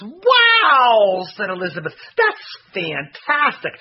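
loud, high, thrilled; excited wow. A narrator's reading. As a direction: gush with delight. Elizabeth praises.

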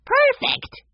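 very high excited shout; delighted. A narrator's reading. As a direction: squeal it with glee. Benny delighted about breakfast.

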